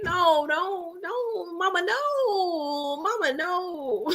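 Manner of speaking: high, drawn-out hammed-up wail; mock wailing protest. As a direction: stretch each no into a high plea. Acts out dismay about celebrities.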